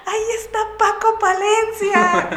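high, loud excited yell across a short distance; mock mom shrieking at her daughter in the airport; mom yelling excitedly. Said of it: "le grita".

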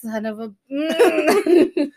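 mock-exasperated, swallowing the last word; stifled curse. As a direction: bite back the swear in mock anger. Parent stifles a curse at child.

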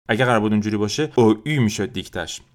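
ordinary talk, nothing marked

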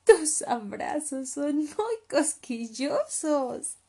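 high, loud, slow ticklish complaint; tickled little cub voice; playful drawn-out protest; through laughter. Acted. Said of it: "entre risas".

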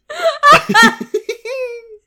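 high squealing burst of laughter; shrieking laugh. Real, not acted. Cracking up at the giant penguin, shrieking laugh. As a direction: explode into a loud, high laugh.

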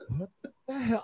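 quiet imitation of stunned reaction; bemused. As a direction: mutter it in baffled surprise. Bemused referee reacts to outburst.

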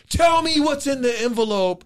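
high, slow mock yell; drawn-out, high demand; confrontational demand. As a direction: imitate an angry challenger squaring off. Acting out rivals squaring off.

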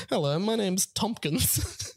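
giggling through the greeting; slow, laughing hello; laughing replay of voicemail greeting. Shown as a demonstration. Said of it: "let's, um".

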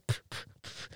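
soft puffs of air as punches; imitated boxing punches. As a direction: puff out three quick mock jabs. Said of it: "I start fake boxing, and go".

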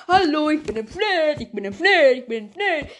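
lightly put-on voice; cut-in voice. Cut-in voice announcing itself in the edit. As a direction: pop up as a voice in the edit. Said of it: "in den Schnitt mit reingearbeitet".

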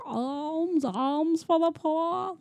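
high, soft, drawn-out beggar's plea; old blind beggar. Retold or imitated. Robin disguised as a blind man begging alms, old beggar. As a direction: call for alms slowly in a hammed-up old voice. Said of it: "like the old blind beggar".

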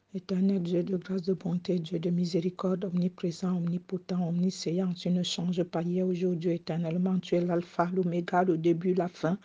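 very soft, reverent prayer murmur; hushed prayer. Real, not acted. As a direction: pray almost under the breath. Soft prayer, much quieter. (prière murmurée, très douce)

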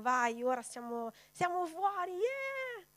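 high, mock-cheering yeah; mock celebration. Mocking the news saying the pandemic is over, mock cheer. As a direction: cheer like it's all over.

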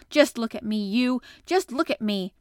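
loud, insistent demand; flung out. A narrator's reading. Boy standing upright challenges old gardener, defiantly loud. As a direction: demand attention, hitting each phrase hard. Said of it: "he flung up at Ben Weatherstaff".

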